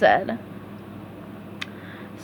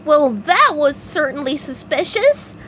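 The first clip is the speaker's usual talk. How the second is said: exclaimed; 5 semitones higher, 4 dB louder than usual. A narrator's reading. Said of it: "SpongeBob exclaimed".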